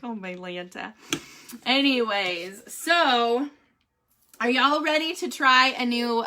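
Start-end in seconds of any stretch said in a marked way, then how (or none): 0.0-0.9 low, soft oh my Lanta; soft exasperation. Retold or imitated. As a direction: sigh it out, worn out. Exasperated joke.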